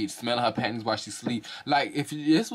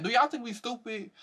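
high, quicker disbelief; incredulous; 11 semitones higher than usual. Real, not acted. Repeating the question to viewers, high and incredulous. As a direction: toss it off in disbelief.

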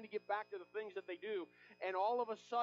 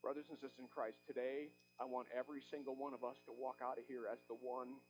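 hushed tender preaching; 8 semitones lower, 7 dB quieter than usual. Acted. Pastor urging his church, quietly and much softer. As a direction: drop it soft and low, intimate. Soft closing appeal to congregation.

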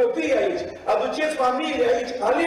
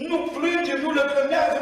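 ordinary delivery

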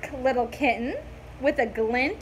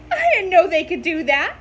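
startled kitten; 3 semitones higher, 7 dB louder, faster than usual. A narrator's reading.

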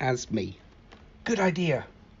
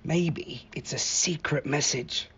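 hushed secret; 7 dB quieter than usual. Acted. Conspiratorial aside to Iggy.

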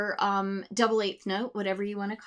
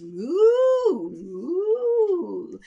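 much higher, louder hammed-up moo; mooing cow; 9 semitones higher, 8 dB louder than usual. Teacher voicing the cow for vocal exploration. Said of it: "the cow goes".

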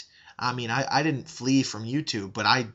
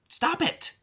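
a raised, exasperated command; angry plea. Real, not acted. Begging the YouTuber to quit, high angry plea. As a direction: stop it, shot much higher in exasperation. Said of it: "I'm sorry that I got angry".

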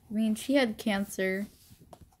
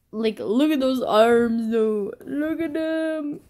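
louder, slow, rising enthusiasm; proud show-off; 3 semitones higher, 6 dB louder, slower than usual. Real, not acted. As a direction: drag it out admiring the arms. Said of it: "showing off drawing".

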